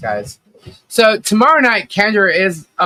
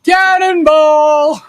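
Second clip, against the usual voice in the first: long drawn-out laughing shout; cannonball yell; 9 semitones higher, 5 dB louder, much slower than usual. Real, not acted. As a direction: belt it out, stretched and slow.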